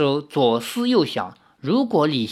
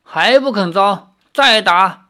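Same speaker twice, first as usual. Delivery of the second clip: sharp, louder command to beat again; a magistrate barking for more blows; angry order; 5 semitones higher, 6 dB louder than usual. Acted. Magistrate orders the guards to beat him again, angry and loud.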